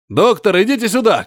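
Шмель calls the doctor over, shouting. A narrator's reading. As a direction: call the doctor across the cellar. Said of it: "крикнул Шмель".

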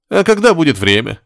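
high, pouting question; sulky. A narrator's reading. Patient rebuffed by the nurse, sulking. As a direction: pout like a rebuffed suitor. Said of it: "надулся Горишвили".

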